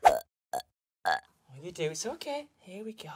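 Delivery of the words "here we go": put-on soothing whisper to a baby. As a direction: hush it like settling an infant. Soothing puppet after wind.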